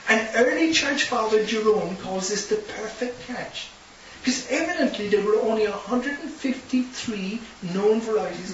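high, loud preacher's proclamation; preacher proclaiming the huge catch to the congregation; preaching at full force. Acted. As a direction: call it out over the congregation.

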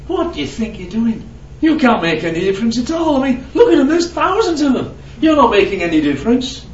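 high, mocking sneer with a laugh; mocking dog walker laughing at the man; scoffing passer-by. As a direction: scoff at him as if he's a fool. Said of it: "he says".

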